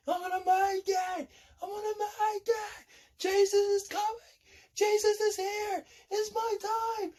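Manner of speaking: very high strained cry, held back in volume; high-pitched desperate mock scream; acted screaming. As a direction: scream it without full volume. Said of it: "I'm screaming in my head".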